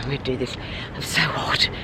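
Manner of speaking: breathless whisper about the heat. Real, not acted. On the street in the heat wave, whisper. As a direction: whisper it, half to herself.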